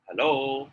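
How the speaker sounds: sample greeting to show intonation; intonation demo. Shown as a demonstration. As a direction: stretch out the end of HELLO. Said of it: "when I say hello".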